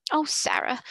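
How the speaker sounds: glad cry. A narrator's reading. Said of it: "she cried out".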